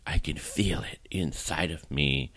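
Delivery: breathy mock whisper, voice dropped away. Wife whispering to husband in bed, mock whisper. Wife's dreamy line retold to husband.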